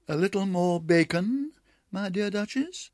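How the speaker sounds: a hint of a sweet, polite hostess; Ribby's hostess voice. A narrator's reading. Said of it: "said Ribby".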